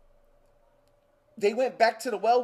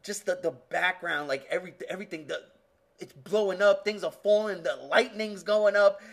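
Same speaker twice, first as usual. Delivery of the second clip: usual speaking voice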